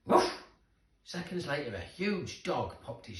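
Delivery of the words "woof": loud barked woof; dog bark. Acted. Reading to children, a loud doggy woof.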